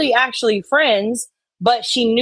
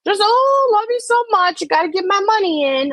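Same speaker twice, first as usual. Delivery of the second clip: mock gushing super-chat fan, much higher; 13 semitones higher than usual. Muscles sucking up to the streamer in a high fawning voice. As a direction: fawn over her in a high, syrupy squeal. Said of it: "there's Muscles giving super chats".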